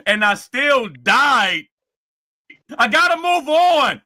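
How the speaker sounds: loud, high mocking whine of a beaten rival. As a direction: yell it like a sore loser crying to himself. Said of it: "You're like".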